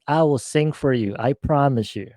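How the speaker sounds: usual speaking voice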